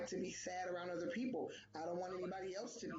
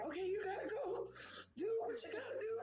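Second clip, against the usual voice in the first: much higher, crying self pep talk; tearful self-talk; 12 semitones higher than usual. Retold or imitated. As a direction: push the words out through tears. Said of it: "I'm bawling my eyes out".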